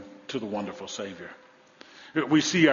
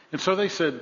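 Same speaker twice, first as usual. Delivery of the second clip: ordinary talk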